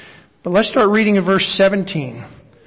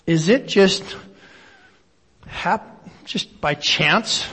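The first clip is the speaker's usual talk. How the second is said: usual speaking voice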